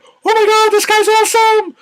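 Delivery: very high, loud, squealing fan delight; shrieking high excitement. Retold or imitated. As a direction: gush like a thrilled fangirl. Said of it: "I was like".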